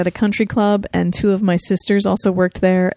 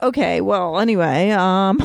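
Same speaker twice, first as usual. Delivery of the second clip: usual speaking voice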